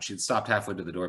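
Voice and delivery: plain reading voice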